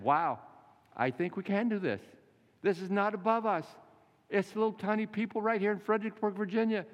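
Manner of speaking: high-pitched, eager burst of hope; excited realization. Retold or imitated. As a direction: disciples thrilled they can do it. Disciples suddenly encouraged.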